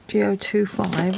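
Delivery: ordinary delivery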